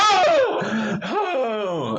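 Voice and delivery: high, loud startled oh; imitating a scared friend's yelp. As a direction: jump out of your skin on the first oh. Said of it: "And he goes".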